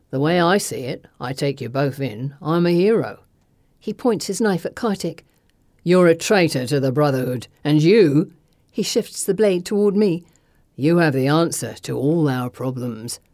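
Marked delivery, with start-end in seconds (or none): 0.1-3.2 low villain voice, self-satisfied; menacing thug. A narrator's reading. Thug weighing up his two captives, menacing. 5.8-8.3 low, threatening villain voice; accusing thug. A narrator's reading. Thug pointing his knife at a traitor, menacing. 10.8-13.2 low-pitched villain voice; menacing thug. A narrator's reading. Thug lecturing his captive on change, menacing.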